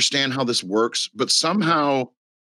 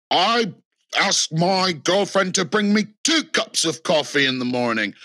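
higher, smug mock bragging; mock Andrew Tate brag; 5 semitones higher than usual. Andrew Tate bragging on the podcast, higher mock swagger. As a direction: imitate a cocky influencer showing off. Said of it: "being like".

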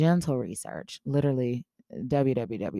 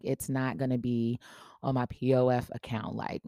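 ordinary delivery

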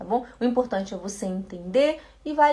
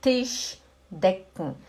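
slow demo; 3 semitones lower, much slower than usual. Shown as a demonstration. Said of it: "pronunciation demo".

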